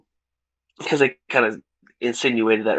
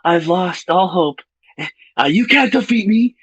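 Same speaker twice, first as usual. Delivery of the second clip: mock movie recreation; 6 semitones higher than usual. Reviewer reenacts the film's villain for friends, hammed-up and high. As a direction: overplay the despair, rising higher at the end. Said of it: "let me do a little recreation for you".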